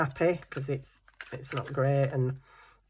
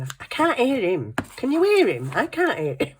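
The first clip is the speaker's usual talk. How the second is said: complaining; 7 semitones higher than usual. Retold or imitated. Mum complaining she can't hear.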